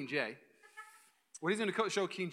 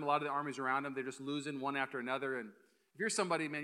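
usual speaking voice

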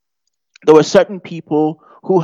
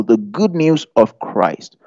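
ordinary talk

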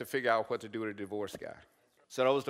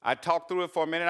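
ordinary talk

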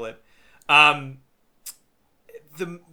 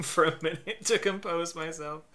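ordinary talk

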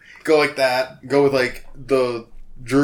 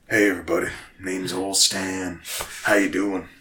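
put-on Old Stan; 3 semitones lower than usual. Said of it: "barbecue sauce commercial redneck voice".

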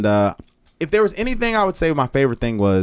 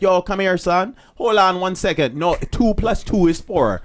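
lightly voiced Jamaican speaker, pitched higher; a hint of Jamaican patois in a raised voice; 6 semitones higher than usual. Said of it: "They're like".

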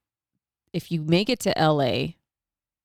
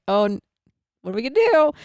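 panicked; 6 semitones higher, 4 dB louder, faster than usual. Retold or imitated. Panicking at the airport.

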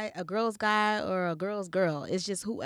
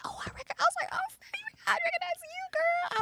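squealed high-pitched hello, far higher; excited high greeting; 4 dB quieter than usual. Retold or imitated. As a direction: gush like spotting a friend. Recalls meeting, greets her excitedly.

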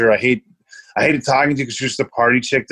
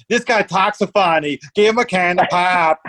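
higher, mocking Minnesota locals; Minnesota accent; 6 semitones higher than usual. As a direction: imitate the teasing locals with their Minnesota accent. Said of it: "they were like".

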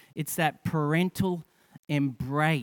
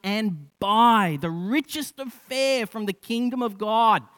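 raised, higher-pitched sermon voice; fervent preaching; 6 semitones higher, 5 dB louder than usual. Acted. Preacher urging the congregation about God's provision, fervent preaching. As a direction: preach it with mounting conviction.

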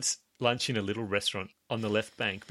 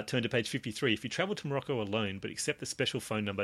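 ordinary delivery

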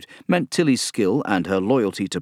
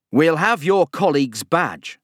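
growling; 8 semitones higher, 4 dB louder than usual. A narrator's reading. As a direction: growl the threat. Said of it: "Barry growled".

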